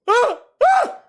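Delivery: sharp high shriek; panicked scream. Real, not acted. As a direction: shriek at a bug. Scared by bug.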